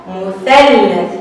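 مثلث stretched very slowly; slow model word. Shown as a demonstration. Teacher to children, modelling مثلث very slowly. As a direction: draw out every sound of مثلث. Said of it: "أث".

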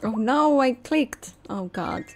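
ordinary talk

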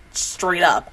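ordinary talk

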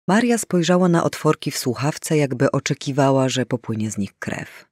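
ordinary delivery

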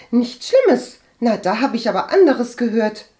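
high, loud incredulous retort; blurted out. A narrator's reading. Cousin doubts Agathe's reassurance, blurting it out. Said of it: "entfuhr es der Base".

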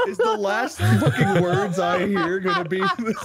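retelling a friend's outrage at being corrected, laughing mock fury; mock exasperated rant. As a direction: rant as if furious but cracking up. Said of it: "J P was so pissed".

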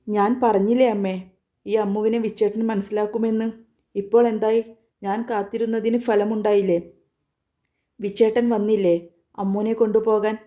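plain reading voice

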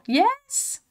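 very high, squealed celebration; high-pitched cry of triumph; excited yes. Real, not acted. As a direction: let it leap right up in delight. Gets the one more wanted.

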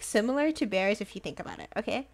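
ordinary talk

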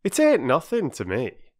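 haughty working-man's indifference; lofty. A narrator's reading. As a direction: dismiss it with injured pride. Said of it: "said Perks loftily".

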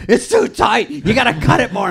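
high, loud mock complaint; frustrated. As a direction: imitate an exasperated performer yelling at the crew. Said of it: "he gets so frustrated".

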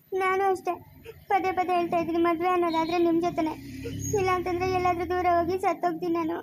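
weepy, desperate insistence; crying ultimatum; tearful. Acted. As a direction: threaten despairingly through tears. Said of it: "ಯಾಕೆ ಅಳ್ತಿರೋದು ನೀನು".

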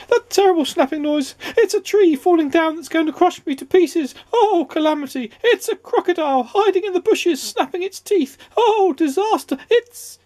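very high, hysterical squealing; terrified shriek. A narrator's reading. Mister Jelly imagining falling trees and crocodiles, terrified shriek. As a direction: babble in squeaky panic until cut short. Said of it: "he shrieked".

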